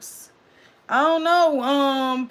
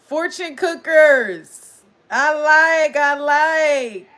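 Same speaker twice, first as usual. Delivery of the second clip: loud, raised-pitch delight; excited; 3 semitones higher, 6 dB louder, much slower than usual. Real, not acted. Streamer delighted by the new group name, loud and excited. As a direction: savour it loudly with real excitement. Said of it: "I got excited".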